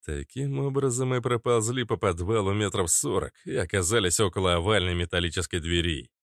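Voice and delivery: plain reading voice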